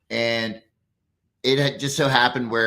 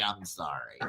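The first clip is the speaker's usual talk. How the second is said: much softer, lower and meek; timid apology; 8 semitones lower, 13 dB quieter than usual. Retold or imitated. The old lady apologizing, meek and much softer. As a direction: shrink away with the words. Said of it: "she's like".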